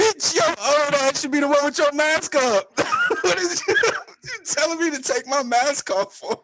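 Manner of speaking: high, loud comic tirade; loud, high-pitched indignant rant breaking into laughter; outraged retort. Retold or imitated. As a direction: yell back in hammed-up outrage. Acted outraged reply to mask remark.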